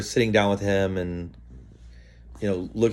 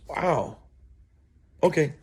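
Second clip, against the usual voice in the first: surprised wow; 7 semitones higher than usual. Retold or imitated. Farmer realizing he loses money.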